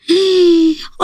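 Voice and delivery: long high gasp of wonder; high-pitched awestruck gasp; delighted gasp. Acted. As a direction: gasp high in thrilled amazement. Sees the demon appear.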